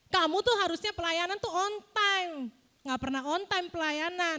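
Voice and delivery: high-pitched acted telling-off; a touch of indignant reproach; mock angry scolding. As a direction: play at scolding someone for lateness. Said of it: "Ih, marah-marah".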